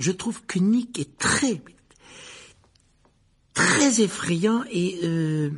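put-on high, halting boy's voice; hesitant flattery. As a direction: grope slowly for the next word. Boy flatters host under prompting.

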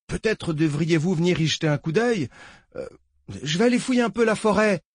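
a jittery lawman reporting in; nervous deputy voice. Acted. Deputy on the radio.

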